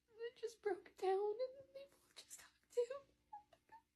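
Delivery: choked up, crying through the words; real tears, high and cracking. Real, not acted. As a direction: voice breaking into a tearful whisper. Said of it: "oh, I'm crying now".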